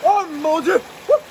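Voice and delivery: cold-shock gasps and yelps; gasping in icy wind. Real, not acted. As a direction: gasp at the freezing plunge. Re-entering icy water.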